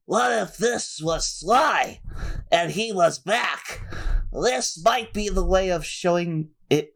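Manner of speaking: high, slow character voice. Acted. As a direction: voice the hunch slowly. Reading a character's thought aloud.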